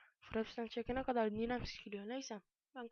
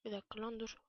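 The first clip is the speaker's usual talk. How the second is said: ordinary talk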